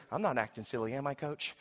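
imitating a boy checking quietly with coach; meek kid reply. Young player turning to answer his coach, meekly.

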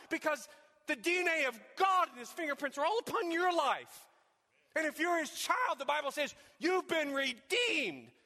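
high, loud preaching voice; fervent preaching. Acted. Preacher picturing the other wounded person, fervent preaching. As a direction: preach it out, pleading.

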